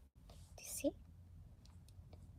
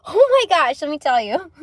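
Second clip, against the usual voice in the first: loud, high burst of excitement; bursting to share news; excited; 6 semitones higher, 11 dB louder than usual. Real, not acted. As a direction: blurt it out thrilled.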